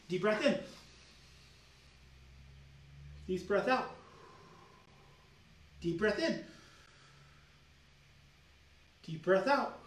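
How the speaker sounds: calm, paced breathing cues; guided breathing. Shown as a demonstration. Coach guiding viewers' breathing, calm and paced. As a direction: lead them through each breath. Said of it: "guides breathing".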